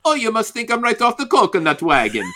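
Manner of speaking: mock a clumsy outsider's Jamaican accent with a laugh. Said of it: "thinking this is how people in Jamaica talk".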